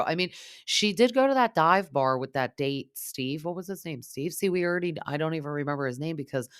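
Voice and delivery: normal talking voice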